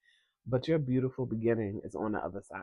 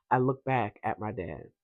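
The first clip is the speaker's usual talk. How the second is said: ordinary delivery, nothing marked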